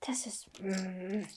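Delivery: very soft, slow mutter; frustrated whisper. Real, not acted. Storyteller losing the thread, whispered frustration. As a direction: whisper it to yourself, stuck. Muttering at own fumbled telling.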